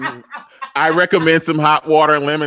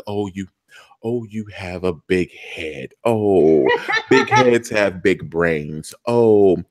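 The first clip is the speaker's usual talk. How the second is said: doting grandpa voice; 7 semitones lower than usual. Retold or imitated. Papa Pope cooing at the baby, lightly lower and doting. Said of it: "and he's like".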